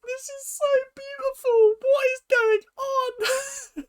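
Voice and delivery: very high, squeaky puppet voice with a tearful catch; half-crying in a tiny high-pitched puppet voice; Elmo voice. Real, not acted. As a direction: overcome with emotion, squeaking it out like Elmo. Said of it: "Why have I gone into my Elmo voice?".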